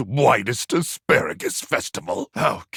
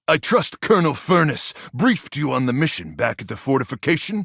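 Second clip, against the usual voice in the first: ordinary delivery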